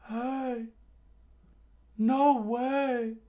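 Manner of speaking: acted amazed squeal; disbelieving exclamation. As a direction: cry out in a put-on high voice. Onlookers react in disbelief.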